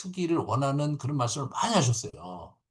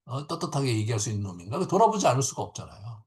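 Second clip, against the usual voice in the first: ordinary talk, nothing marked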